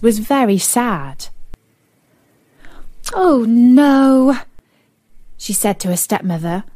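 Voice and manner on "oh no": dismayed princess. Acted.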